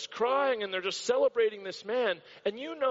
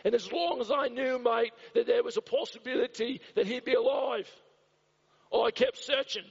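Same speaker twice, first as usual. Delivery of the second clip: higher, broad Aussie bloke voice with mate; salty Australian captain; 5 semitones higher than usual. Retold or imitated. Pastor imitating the Australian captain to the reporter, broad Aussie accent. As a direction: play the gruff Aussie captain telling it straight. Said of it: "he was a salty Australian".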